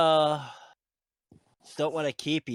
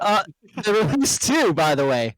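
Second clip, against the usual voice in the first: mic peaking; 4 semitones higher, 6 dB louder than usual. Real, not acted. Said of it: "your mic is peaking so bad".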